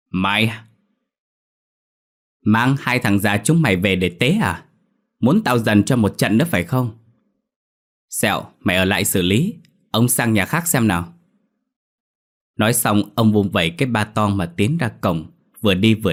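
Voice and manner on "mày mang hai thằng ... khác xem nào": mildly acted angry threat; landlord's narrated rebuke, a touch forceful; scolding shout. A narrator's reading. Landlord threatening the old couple with another beating, scolding. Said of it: "Ông quát".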